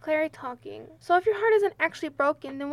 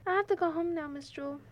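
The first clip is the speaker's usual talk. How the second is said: sad; 8 dB quieter than usual. Acted. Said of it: "waves sadly".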